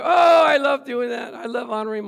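high, shouted gush of joy; exuberant delight. Acted. Preacher relishing honoring his parents, exuberant delight. Acting delight in obeying commandment.

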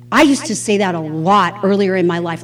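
ordinary delivery

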